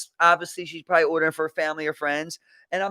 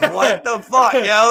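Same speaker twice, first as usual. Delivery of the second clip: loud, higher-pitched disbelief; stunned, raised-voice reaction; shocked outburst; 5 semitones higher, 11 dB louder, slower than usual. Retold or imitated. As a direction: blurt it out loud in mock shock. Shocked reaction in retold confrontation.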